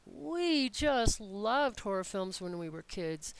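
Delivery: "We" hit hard, whole line slowed right down; stress on we. Shown as a demonstration. Teacher demonstrating English emphasis on "We". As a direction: lean on "We" to set us against someone else. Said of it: "extra stress on those, on the word we".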